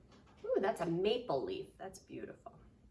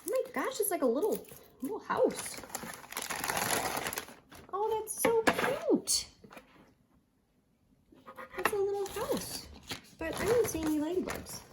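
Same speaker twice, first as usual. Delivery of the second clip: delighted cooing; 12 semitones higher than usual. Real, not acted. Teacher showing children the little house, delighted and high. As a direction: coo over it in a high, excited voice. Opening ladybug kit for children.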